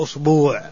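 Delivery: أصبوع as "usbuu'", long final vowel; tenth variant form. Shown as a demonstration. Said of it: "والعاشرة".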